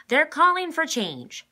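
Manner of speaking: high, loud, slow reporter voice; news reporter. A narrator's reading. The reporter speaks to the camera, high and loud. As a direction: announce it like a TV reporter. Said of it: "said the reporter".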